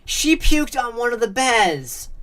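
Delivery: high, loud tattling shout; shouted disgust. A narrator's reading. Sister announcing the mess on the bear, disgusted shout. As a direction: call it out to the whole room, high and loud. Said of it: "someone shouted".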